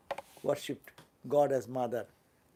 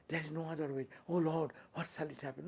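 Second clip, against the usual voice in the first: mock desperate plea; 8 dB quieter, faster than usual.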